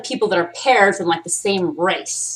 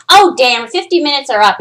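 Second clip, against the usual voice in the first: loud, higher-pitched cut-off; mock dismay as the session runs out; hammed-up therapist calling time; imitated therapist; 6 semitones higher, 8 dB louder than usual. Acts therapist ending the session.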